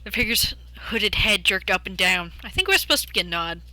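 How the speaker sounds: ordinary delivery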